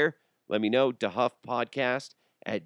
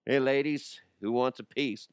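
cheesy street hawker call; hammed-up sleazy pitch; mock hawker; 5 semitones higher than usual.

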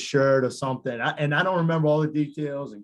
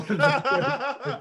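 short laugh, much higher; a quick squeal of laughter; high little laugh; 13 semitones higher than usual. Real, not acted. Still laughing at the story.

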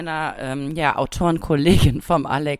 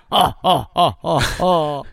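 pirate voice; 2 semitones lower than usual. Pretend. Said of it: "Und das Ganze jetzt nochmal in Piratensprache". (leichte Piratenstimme)

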